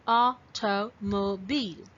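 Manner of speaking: "Automobile" slowly, syllable by syllable; four spaced beats on "Automobile"; broken into syllables. Shown as a demonstration. Teacher repeats for counting, "Automobile" split into syllables. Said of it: "when you break this word".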